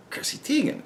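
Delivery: "Chrissy Teigen" breathed out softly as a whisper; whispered realization. Real, not acted. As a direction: whisper the name as it clicks. The name suddenly clicks.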